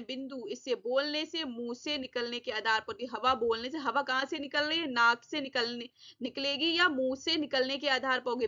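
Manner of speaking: ordinary talk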